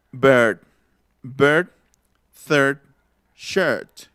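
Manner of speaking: bird, third, shirt said singly with a tight central ER vowel; English vowel demo. Shown as a demonstration. Teacher modelling another English vowel for the chat, careful pronunciation demo. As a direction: isolate bird, third, shirt, each on a closed r-coloured vowel. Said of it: "que se pronuncia".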